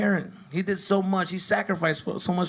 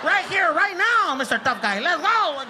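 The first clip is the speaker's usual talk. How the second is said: much higher, loud macho challenge; dad shouting; 12 semitones higher, 4 dB louder than usual. Retold or imitated. Dad challenging the ref to a fight, high and loud. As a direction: bellow a dare at the tough guy.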